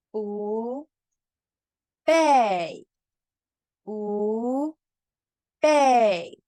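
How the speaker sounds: 用清楚的声调示范; tone drill. Shown as a demonstration. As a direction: model each tone for class to repeat. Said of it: "latihan pengucapan dan nada".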